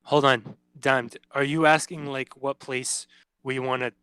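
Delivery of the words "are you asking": ordinary talk, nothing marked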